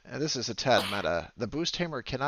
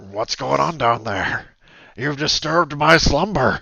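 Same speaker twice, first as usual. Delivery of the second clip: booming, slow beast woken from sleep; loud slow growl of a disturbed monster; wolf voice; 10 dB louder, slower than usual. Acted.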